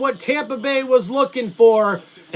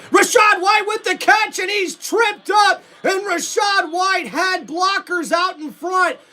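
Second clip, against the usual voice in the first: excited play call; 6 semitones higher than usual. Acted. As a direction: jump up as the catch comes in. Big catch in live call.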